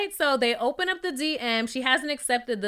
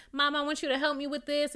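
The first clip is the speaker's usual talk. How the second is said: ordinary delivery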